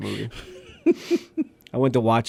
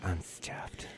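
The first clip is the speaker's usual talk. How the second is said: breathy stage whisper; 5 semitones lower, 18 dB quieter than usual. Pretend. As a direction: whisper it like a secret. Joking about being full.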